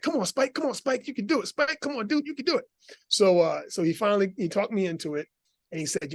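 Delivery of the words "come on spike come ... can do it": high, sing-song cheer to a puppy dog. Retold or imitated. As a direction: coax him like an eager little puppy. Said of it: "the little puppy that was just like".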